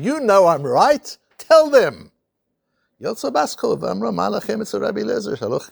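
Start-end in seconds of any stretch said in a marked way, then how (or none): 0.0-2.1 high, loud pleading cry to heaven; upset plea. Retold or imitated. Rabbi Eliezer appealing to heaven in frustration, loud high plea. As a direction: beg heaven to back you up, loudly. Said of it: "getting really upset".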